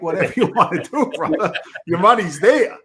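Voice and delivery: amused brush-off to a friend; laughing retort. Retold or imitated. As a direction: laugh off his complaint, teasing. Teasing Mikey about early payout.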